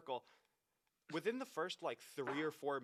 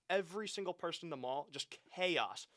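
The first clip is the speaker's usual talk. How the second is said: ordinary talk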